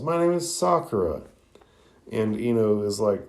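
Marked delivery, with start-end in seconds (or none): 0.0-1.2 high-pitched angry retort, not truly shouted; acted angry outburst at moderate volume; mock angry yell. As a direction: push the pitch up in indignation. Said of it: "Sakura angrily yells".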